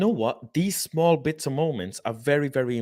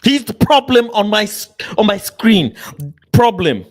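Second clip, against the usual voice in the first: loud exclaim; 5 semitones higher, 10 dB louder than usual. Real, not acted. Shouting at the interview on screen.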